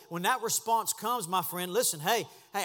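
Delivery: normal talking voice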